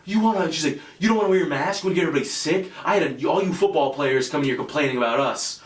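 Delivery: mock a panicked manager shrieking at him; freaking out manager. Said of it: "comes up to me freaking out".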